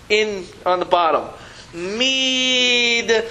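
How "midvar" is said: 1 slow reading aloud. Shown as a demonstration. Teacher reads the box words.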